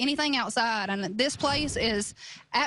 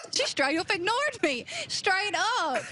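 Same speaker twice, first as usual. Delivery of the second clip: laughing; 7 semitones higher, slower than usual. Real, not acted. Laughing after Siri ignores her.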